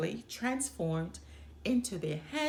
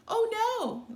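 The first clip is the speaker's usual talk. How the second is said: high, louder cry of dismay; a spoiled princess losing her toy; 12 semitones higher, 4 dB louder than usual. Acted. Older sister at the pond, high, loud dismay. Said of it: "the older sister said".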